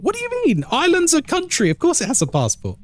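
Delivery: high-pitched, astonished disbelief; incredulous. Real, not acted. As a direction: voice leaps up, baffled at the viewer. Astonished at viewer's passport remark.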